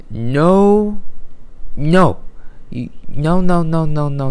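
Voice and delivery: repeated high no, no, no; emphatic refusal. Real, not acted. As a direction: shoot the idea down over and over. Fan rejecting draft pick.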